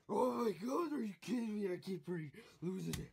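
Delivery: loud frustrated outburst; gamer rage. Acted. Raging at the game after losing again, loud outburst. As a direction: blow up at the game.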